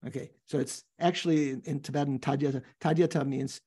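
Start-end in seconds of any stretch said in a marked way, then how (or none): none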